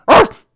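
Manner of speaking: loud, silly dog bark; one sharp, goofy bark. Acted. Follows crazy, dog noise.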